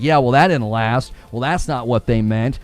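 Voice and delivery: lightly voiced low apologist; mock excuse-making fan. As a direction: drop low for a hint of the excuse-maker. Voices gullible fans making excuses.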